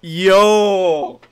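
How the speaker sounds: long, loud, drawn-out cry of amazement; amazed shout. Real, not acted. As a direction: stretch it out loud in disbelief. Amazed by game mechanic.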